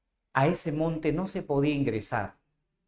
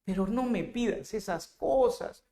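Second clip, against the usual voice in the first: a hint of a spoiled girlfriend; lightly voiced sulky girlfriend; 5 semitones higher than usual. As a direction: push him away with a pout. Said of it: "Y ella hincha su cachete".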